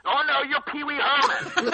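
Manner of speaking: high, slow, loud mock thug voice, half laughing; tough guy insisting. Thug on the street insisting to the actor, mock tough voice. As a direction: drag it out like a thug who won't be argued with. Thugs insisting he is Pee Wee.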